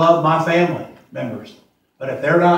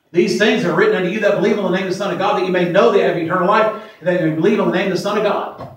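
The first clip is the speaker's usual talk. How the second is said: rapid scripture recitation; 3 semitones higher, faster than usual. Acted. Quoting scripture fast.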